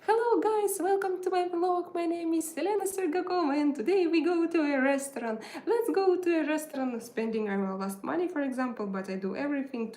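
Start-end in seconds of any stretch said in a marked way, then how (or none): 0.1-7.0 high, loud, fast mock vlog intro; mock perky vlogger. Mocking her old vlogs to viewers: bright, chirpy, put-on host voice. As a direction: ham up a fake-cheerful old vlog greeting. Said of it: "So it was like".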